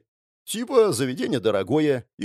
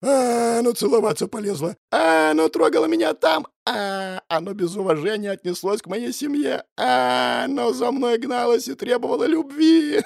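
mocking imitation; 10 semitones higher, 4 dB louder than usual. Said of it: "У вас смешнее получалось".

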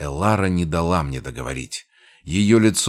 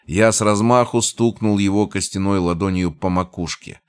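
ordinary delivery

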